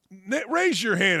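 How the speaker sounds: ordinary delivery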